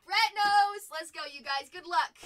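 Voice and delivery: shouted with energy; loud hype. Real, not acted. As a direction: belt it out to the chat. Big hype moment.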